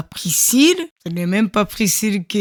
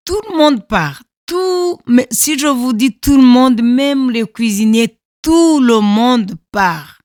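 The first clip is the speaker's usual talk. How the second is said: louder and higher, hammering tout le monde; emphatic insistence; 5 semitones higher, 8 dB louder than usual. Real, not acted. Survivor stressing to listener everyone abandoned her, loud insistence. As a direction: stress that truly everyone left.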